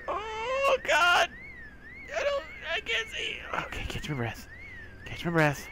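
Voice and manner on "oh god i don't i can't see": much higher, crying out in fear; terrified wail; panicked cry. Real, not acted. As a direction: shriek with fright. Panicking in dark game.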